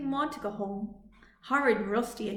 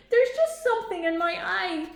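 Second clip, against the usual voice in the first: sniffly; 8 semitones higher, 5 dB louder than usual. A narrator's reading. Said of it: "he sniffed".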